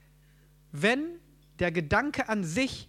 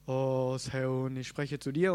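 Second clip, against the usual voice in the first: lower, solemn booming God voice, lightly hammed; mock voice of God; 6 semitones lower, slower than usual. Preacher imitates God speaking to him for the congregation, lower and solemn. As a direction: intone it like a heavenly voice from above. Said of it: "Ist die Stimme Gottes so".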